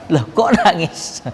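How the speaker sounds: high, slow baffled "kok nangis"; astonished. Retold or imitated. Man astonished to see the younger brother crying, high and slow. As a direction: retell a man's amazement, drawn out. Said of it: "tambah heran orang ini".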